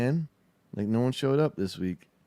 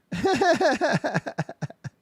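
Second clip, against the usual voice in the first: high, rapid peal of laughter; real laughter. Real, not acted. Streamer after insisting they did answer, high bursting laughter.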